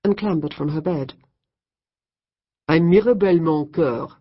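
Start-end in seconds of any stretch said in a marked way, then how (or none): 2.7-4.1 put-on louder, smoky heavy French accent; thick French accent. A narrator's reading. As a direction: introduce herself in thick French. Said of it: "in a French accent as thick as nightclub smoke".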